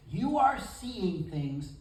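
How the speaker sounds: very slow, measured correction; Jesus correcting Peter, slow and measured; slow and deliberate. Retold or imitated. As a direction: lay each word down slowly. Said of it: "he actually drops another line".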